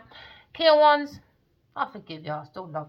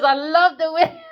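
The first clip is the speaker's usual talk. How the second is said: high, loud, laughing through the words; laughing quote; 8 semitones higher, 8 dB louder than usual. Retold or imitated. Quoting him in the dream, laughing and high. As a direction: giggle the line out.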